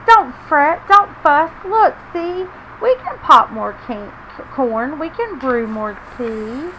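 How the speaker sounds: small mouse soothing big bear; squeaky Mouse. Acted. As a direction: comfort the bear in a tiny squeak. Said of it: "Mouse squeaks".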